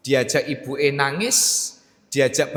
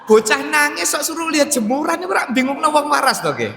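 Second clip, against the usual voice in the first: exasperated outburst; 10 semitones higher, 7 dB louder than usual. Real, not acted. Father recalling his mother's advice, loud exasperated disbelief. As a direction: voice jumps high and loud in disbelief.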